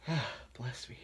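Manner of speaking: ordinary talk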